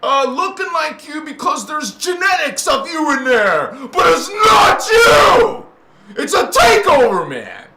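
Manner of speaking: real emotional cry at the audience; emotional outburst. Real, not acted. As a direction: shout it out, near tears. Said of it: "Sorry I got so emotional".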